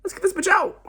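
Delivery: high, lightly mocking Spencer voice. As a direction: imitate a scheming teammate. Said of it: "because he said".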